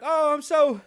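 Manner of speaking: high-pitched, hammed-up happiness; mock a prince insisting he is delighted; put-on, over-cheerful prince voice; Harry impression. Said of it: "I'll be Harry".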